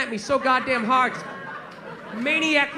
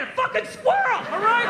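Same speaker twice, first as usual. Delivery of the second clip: higher, exasperated retort; indignant; 8 semitones higher than usual. Retold or imitated. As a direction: defend yourself, fed up and a little embarrassed. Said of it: "tell that story".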